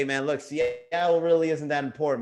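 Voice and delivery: usual speaking voice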